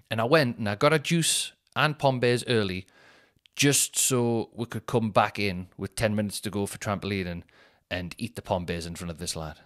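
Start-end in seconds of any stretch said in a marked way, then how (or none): none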